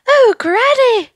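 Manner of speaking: put-on child shouting to granny; joyful cry. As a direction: call out overjoyed. Nils runs out crying.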